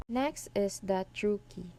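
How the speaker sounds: normal talking voice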